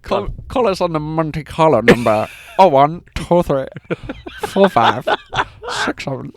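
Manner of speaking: hammed-up Little Britain character voice; mock sketch-show phone plug, broken up by laughter; Bubbles De Vere. Teasing co-host's accent with a hammed-up Little Britain impression. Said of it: "You sounded like, did you ever used to watch Little Britain?".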